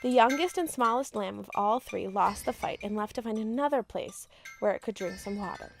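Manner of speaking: usual speaking voice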